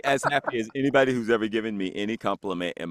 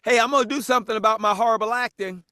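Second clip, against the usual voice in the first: retold yell, pitched up and hurried; mock yell across store; 8 semitones higher, faster than usual. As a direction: call out brightly to someone far off. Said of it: "I yell across the store".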